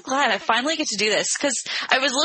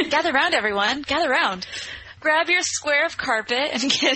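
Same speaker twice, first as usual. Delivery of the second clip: storytime teacher; 5 semitones higher than usual. Pretend.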